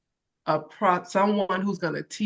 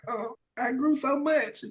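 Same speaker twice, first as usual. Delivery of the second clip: tearful; 6 semitones higher, 4 dB quieter, slower than usual. Real, not acted. Tearful thanks after crying.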